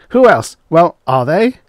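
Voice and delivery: high, loud, sputtering outburst. A narrator's reading. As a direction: splutter it out, beside himself. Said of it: "He sputtered".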